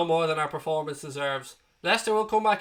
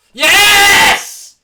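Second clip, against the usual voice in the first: real ecstatic yell, much higher and much louder; goal scream; 17 dB louder than usual. Real, not acted. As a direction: scream the goal straight into the mic. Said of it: "my screaming down the microphone".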